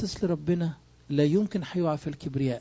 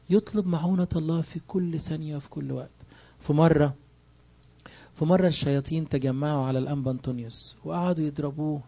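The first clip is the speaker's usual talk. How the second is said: ordinary talk, nothing marked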